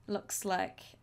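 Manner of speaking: ordinary talk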